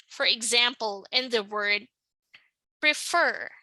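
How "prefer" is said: "prefer" stressed on its second syllable; "prefer" as pre-FER, second syllable prominent. Shown as a demonstration. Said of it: "The stress is on the second syllable".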